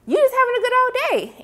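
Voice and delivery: high, mocking mother voice; mock scolding mother. Mother mocking her son for slacking, high mock scold. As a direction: ham up the nagging mom.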